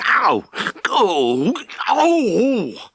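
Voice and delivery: sharp high yelps of pain. A narrator's reading. Farmer yelping as the cow crushes his foot, pained yelps. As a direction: cry out as the hoof crushes your toe. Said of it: "yelled Mister Daggett".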